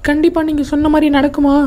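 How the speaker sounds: put-on high, pleading kid voice; lost child. Child asks whether mother will be found, high child voice.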